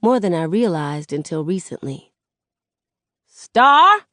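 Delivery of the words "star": high, loud call from the door. A narrator's reading. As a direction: holler for someone two streets away. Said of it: "Nana calls from the front door".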